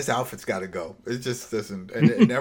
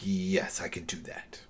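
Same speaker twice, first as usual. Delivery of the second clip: hushed whispered promise; a conspiratorial whisper, barely voiced; 6 semitones lower, 10 dB quieter than usual. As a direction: whisper it so no one hears. Goon agrees secretly.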